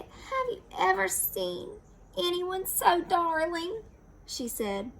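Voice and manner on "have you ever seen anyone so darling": high, gushing wife; adoring. Acted. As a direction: gush over the piglet. Wife adores piglet.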